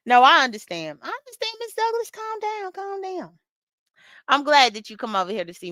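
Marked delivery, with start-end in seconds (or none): 1.0-3.4 high, soft, placating calm-down; mock soothing. To upset Miss Douglas, a high soft mock-soothing calm down. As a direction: pretend to settle her down gently.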